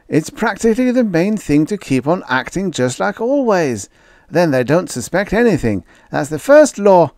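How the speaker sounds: put-on high boyish voice, lecturing his friend; a kid laying down the rules; young boy arguing his case; argumentative boy. A narrator's reading. Red argues, boy voice.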